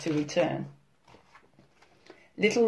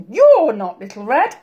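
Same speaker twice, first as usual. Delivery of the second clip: loud, higher granny yell; alarmed storybook shout; 5 semitones higher, 7 dB louder than usual. A narrator's reading. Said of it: "yelled Granny".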